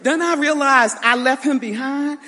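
laughing as she remembers running from the furnace blast; laughing through it. Real, not acted. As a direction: tell it while you can't stop laughing. Laughing while retelling the explosion.

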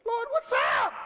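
high, loud shouted plea; mock cry to God. Congregation imagined crying out to God, high shouted plea. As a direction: throw it up to heaven, half joking.

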